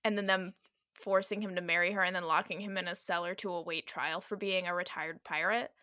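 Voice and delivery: ordinary delivery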